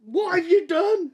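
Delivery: high, slow, shocked and laughing; aghast, half laughing; high mock-horrified laugh-cry; shocked mum. Mum shocked at child's Alexa trick.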